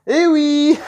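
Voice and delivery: high, loud, gleeful cry; excited exclamation. Real, not acted. Vlogger reveals his new figure to viewers, gleeful shout.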